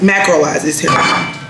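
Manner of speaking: ordinary delivery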